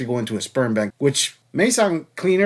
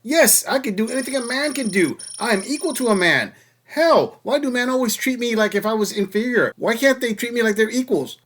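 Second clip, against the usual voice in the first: pitched-up parody of an indignant woman; mock demanding woman; 7 semitones higher than usual. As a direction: whine it like a caricatured queen. Mocking women demanding equality.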